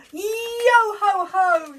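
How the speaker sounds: high, slow, loud pirate yo ho ho; pirate cry. Acted. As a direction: stretch it out like a jolly pirate. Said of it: "said Jim".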